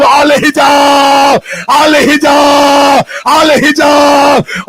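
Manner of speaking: slow, loud, high wail of each word; long mournful shouted cry; drawn-out shouted call. Acted. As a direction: call it out to the sky, stretched. Crying out to the sky.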